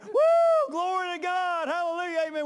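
high, loud shout of praise; joyful shout. Retold or imitated. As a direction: cry it out to the heavens.

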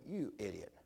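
hushed "you idiot" to yourself; muttered self-scolding. Retold or imitated. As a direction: scold yourself under your breath. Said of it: "get mad at myself and go".

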